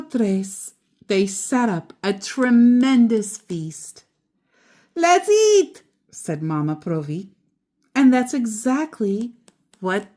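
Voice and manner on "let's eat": high and louder, bursting with joy; happy call. A narrator's reading. Mama Provi to Lucy, joyful high call. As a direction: call everyone to the table.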